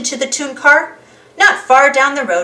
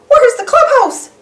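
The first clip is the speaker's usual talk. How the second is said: high, loud Mickey cry; cried out; 13 semitones higher, 6 dB louder than usual. Acted. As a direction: call out in alarm. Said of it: "cried Mickey".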